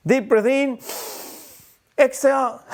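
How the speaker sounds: mock event host leading a breath; event breathing instructor. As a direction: imitate a chirpy breathing coach. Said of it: "when you go to an event and they say".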